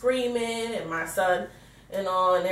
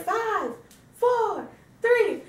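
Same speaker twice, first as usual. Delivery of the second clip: high-pitched mock kids' shout; girls' excited countdown; 13 semitones higher, much slower than usual. Daughters counting down to the ball drop, imitated high shout. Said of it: "the girls were in screaming".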